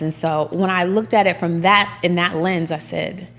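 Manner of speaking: normal talking voice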